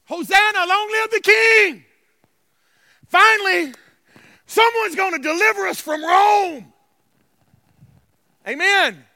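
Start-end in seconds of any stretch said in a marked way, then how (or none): 0.0-6.7 high, loud cheer of relief; jubilant shout. Retold or imitated. Preacher voices crowd awaiting deliverance, jubilant shouting. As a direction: proclaim it like a crowd set free at last. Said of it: "they're saying".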